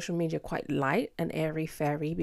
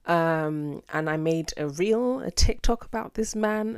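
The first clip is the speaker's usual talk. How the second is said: normal talking voice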